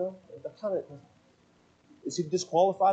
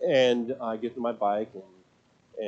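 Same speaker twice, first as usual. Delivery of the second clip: ordinary talk, nothing marked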